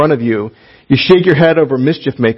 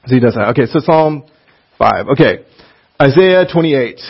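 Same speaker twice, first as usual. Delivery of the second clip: normal talking voice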